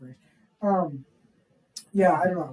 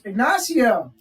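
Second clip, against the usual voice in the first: raised, loud imitated name call; wholehearted greeting; 3 semitones higher, 6 dB louder, much slower than usual. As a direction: hail someone by full name, in earnest. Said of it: "meant it, like, so wholeheartedly".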